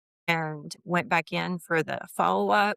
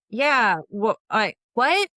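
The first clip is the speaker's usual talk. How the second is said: higher and louder, tripping over words; retold flustered what; stammering disbelief; 4 semitones higher, 6 dB louder, slower than usual. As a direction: lose your words in surprise. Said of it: "it was just like".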